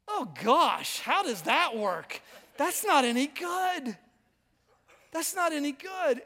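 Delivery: drawn-out cringing disbelief at yourself; appalled self-critique. Retold or imitated. Pastor watching his own sermon video, appalled. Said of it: "I watch it and I go".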